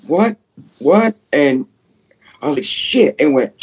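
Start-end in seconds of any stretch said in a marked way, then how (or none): 0.1-1.2 high, alarmed double question; startled. Retold or imitated. Hearing his own voice copied, high and startled. Retold shock at mimicked voice. 2.6-3.2 high, alarmed exclamation; startled curse. Retold or imitated. Alarmed by the doll, a high startled curse.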